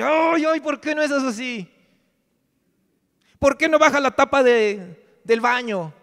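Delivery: high, whiny mock complaint from the wife; complaining wife. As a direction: play the fed-up wife, shrill and exasperated. Esposa quejándose, escena actuada. (esposa quejumbrosa, voz aguda y exagerada)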